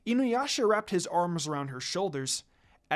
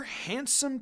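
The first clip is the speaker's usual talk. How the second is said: plain reading voice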